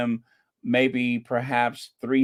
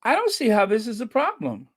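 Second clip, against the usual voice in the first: exasperated; 7 semitones higher, faster than usual. Real, not acted. Repeats it, more exasperated.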